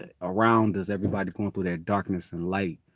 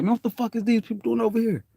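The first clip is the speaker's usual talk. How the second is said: higher, fast disbelief; startled kid; 13 semitones higher, faster than usual. Retold or imitated. His younger self seeing strangers, higher and fast. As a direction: rattle it off like a surprised kid.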